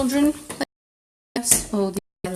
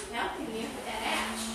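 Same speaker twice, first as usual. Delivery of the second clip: ordinary talk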